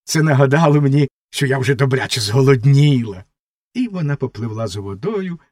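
usual speaking voice

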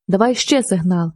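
plain reading voice

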